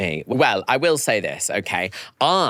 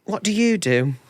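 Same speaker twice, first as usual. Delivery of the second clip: higher, oblivious asking; innocent question; 11 semitones higher than usual. Retold or imitated. Telling how he asked [name], high and oblivious. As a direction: ask it brightly, clueless. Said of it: "I said".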